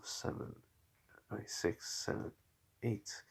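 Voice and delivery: very quiet, half-voiced counting; barely audible thinking aloud; counting to oneself. Real, not acted. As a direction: count under your breath, working it out. Counting levels to himself.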